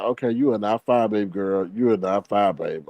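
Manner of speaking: lightly mimicking a smug diva, soft and low; fine babe impression. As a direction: drop low and purr the sass at her. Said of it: "that's what the fine babe used to do".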